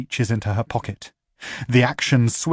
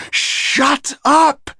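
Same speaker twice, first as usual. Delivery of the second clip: much higher, loud angry yell; furious shout; 6 dB louder than usual. Acted. Duet yelling at the infernal to shut up. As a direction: bark it to shut him up.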